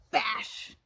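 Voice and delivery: loud breathy crash; crash sound. Pretend. The rocket crashing down, loud breathy bash. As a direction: hit the bash like an impact.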